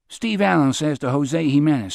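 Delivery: usual speaking voice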